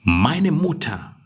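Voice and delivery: high and slow; slow correction. Shown as a demonstration. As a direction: model the corrected words slowly. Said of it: "check the spelling for Mutter".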